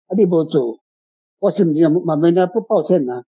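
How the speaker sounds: usual speaking voice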